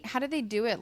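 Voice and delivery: ordinary delivery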